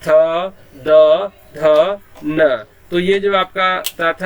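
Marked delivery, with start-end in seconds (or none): 0.0-2.6 थ, द, ध, न spoken louder with the tongue on the teeth; dental consonants spelt out. Shown as a demonstration. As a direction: tap each dental consonant out clearly. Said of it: "अभी यहाँ पे दिया हुआ है त". (दाँतों से थ, द, ध, न ज़ोर से)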